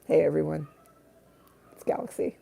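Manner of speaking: voicing the cat. Owner voicing her cat Galaxy to viewers, lightly hushed pet voice. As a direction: speak for the cat in a small hushed voice. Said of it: "She wanted to say hi".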